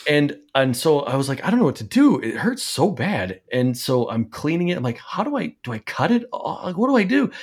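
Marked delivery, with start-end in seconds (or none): 1.4-3.4 panicked whine. Retold or imitated. Panicked quote about bent toenail. 5.0-7.3 high, frantic questions; panicked. Retold or imitated. Panicked quote while cleaning toe.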